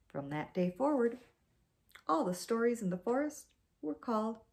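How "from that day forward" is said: plain reading voice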